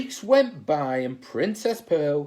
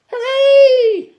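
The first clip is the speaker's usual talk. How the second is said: high, loud cheer of joy; joyful cry; 15 dB louder than usual. Acted. Freed princess cheering, high and loud. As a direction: shout hooray with delight. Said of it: "cried Princess Pearl".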